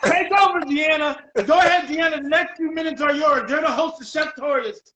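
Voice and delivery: loud, high, rousing announcement; excited handover. Real, not acted. Host handing the show to guest, excited. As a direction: hype up the new host.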